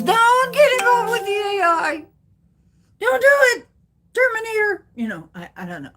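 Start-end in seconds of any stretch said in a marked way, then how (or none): 0.0-4.9 high, loud doom-sayer warning; mock warning voice. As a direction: warn about AI like a scared prophet. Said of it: "everybody says".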